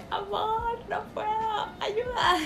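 put-on high, crying-laughing plea to her boyfriend; imitating her own panicked sobbing; tearful plea. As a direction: whimper it out half in tears, half laughing. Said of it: "me puse a llorar".

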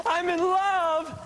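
lovestruck gush. Acted. Clarence gushing over new love.

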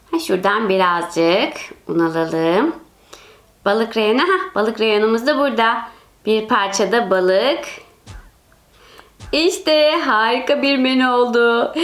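scheme it in a deep sly voice; low villain voice. Acted. Kötü amca markette. (kötü amca markette, alçak ve sinsi sesle)

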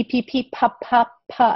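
repeated popped P sounds; sounding out P. Shown as a demonstration. Teacher stressing the P ending of soup. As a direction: pop the P over and over. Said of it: "it ends with p".

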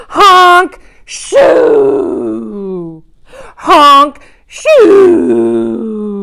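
big cartoon snore, honk then long shoo; loud snoring sounds. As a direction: ham up a loud dinosaur snore. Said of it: "all the snoring begins".

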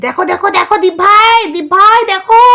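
high, pleading mimicry; sarcastic high-voiced imitation; mocking the sidekick. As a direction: whine it like a coached sidekick. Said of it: "দালালকে শিখিয়ে দিয়েছিস".

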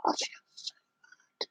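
barely audible guilty whisper. Real, not acted. Guilty youtuber whispering apology to viewers. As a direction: breathe it out in a whisper. Distraught over bad video.

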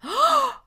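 high shocked gasp; sharp gasp. Acted. Shocked at list.

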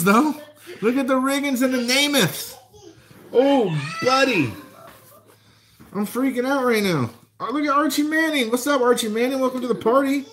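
high, giddy excitement over the cards; can barely contain the hype; thrilled. Real, not acted. Pulling star rookie cards, freaking out.

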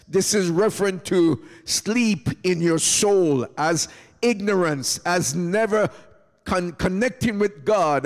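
normal talking voice

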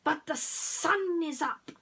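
high, slow snaky worry; hissing snake. Acted. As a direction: draw it out like a nervous serpent. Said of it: "hissed Hiss".

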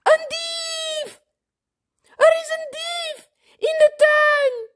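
put-on very high, loud child's shouting; high-pitched frightened yell; panicked alarm. A narrator's reading. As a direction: raise the alarm in a shrieking panic. Boy raises alarm about a thief.

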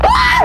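very high, loud scream; terrified scream. Real, not acted. Player hit by a jump scare, screaming. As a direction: scream in shock.

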